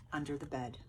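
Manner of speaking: ordinary delivery, nothing marked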